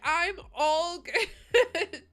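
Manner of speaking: high, slow, forced cheer breaking into laughter; bright brave face while the tears come; laughing through tears. Real, not acted. Insisting she's fine through tears.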